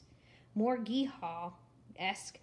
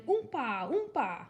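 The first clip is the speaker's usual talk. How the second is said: mild shout; 3 semitones higher, slower than usual. A narrator's reading. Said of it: "playing accordions and shouting".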